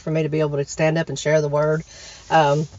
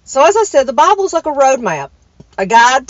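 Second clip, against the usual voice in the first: excited preaching; 5 semitones higher, 4 dB louder than usual. Real, not acted. Preaching point.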